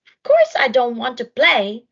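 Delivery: loud cross refusal; grumpy snort. Acted. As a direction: bark the refusal like a sulky hippo. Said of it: "snorted Hudson".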